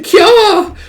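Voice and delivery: high, loud drawn-out shriek; mock scream. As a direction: shriek as if you found a body. Acted scream on finding the body.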